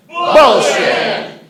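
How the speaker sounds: loud, high shouted Bullshit; group shout. Real, not acted. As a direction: shout it out together, full voice. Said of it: "in your best voice to say".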